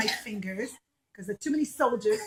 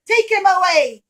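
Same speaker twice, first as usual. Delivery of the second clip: loud, higher angry order; angry king; 6 semitones higher, 9 dB louder than usual. Acted. Angry king ordering guards, loud.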